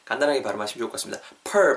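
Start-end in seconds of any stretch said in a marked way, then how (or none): 1.4-1.8 "per" with a curled English R sound; wrong added R. Shown as a demonstration. Teacher warning learners, "per" with a wrongly added R. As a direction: say "per" with a clear r-coloured vowel. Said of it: "알사운드 넣지 마시고요".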